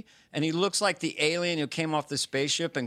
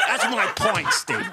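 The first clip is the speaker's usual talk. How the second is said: louder, laughing tough-guy Fauci; Fauci impression; 3 semitones higher, 5 dB louder than usual. Host ends his Fauci bit, louder and laughing. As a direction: punch the end of the bit, laughing. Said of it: "it's not my most accurate impression".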